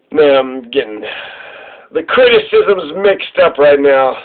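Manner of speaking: ordinary delivery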